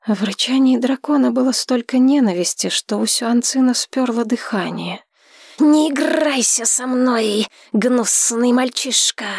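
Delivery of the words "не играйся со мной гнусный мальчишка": slow snarling dragon; angry dragon. A narrator's reading. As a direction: growl at the boy as a beast.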